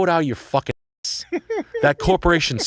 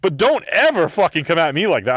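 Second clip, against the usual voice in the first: heated retold rant; 5 semitones higher than usual. To a coworker who gave a canned apology, heated rant. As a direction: lay into him, voice raised.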